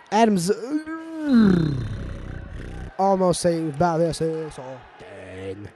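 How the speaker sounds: lively live commentary, building excitement; play-by-play. Acted. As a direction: call the game like a sports announcer. Play-by-play resumes.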